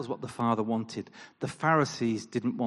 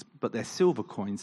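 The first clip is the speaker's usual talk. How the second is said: ordinary delivery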